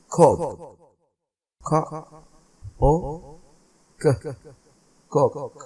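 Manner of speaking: कोक split into ख, ओ, क then blended; teacher sounding out कोक; sounded out word. Shown as a demonstration. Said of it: "teacher segmenting word कोक".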